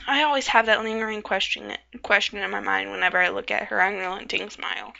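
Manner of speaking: usual speaking voice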